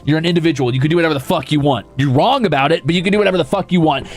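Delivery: usual speaking voice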